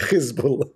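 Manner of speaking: Hezbollah with a scraped kh, rising; Hebrew throaty kh. Shown as a demonstration. Mocking the Israeli way of saying Hezbollah, throaty kh. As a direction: rasp the h in Hezbollah. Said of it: "it's even insulting".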